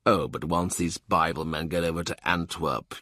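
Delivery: ordinary delivery, nothing marked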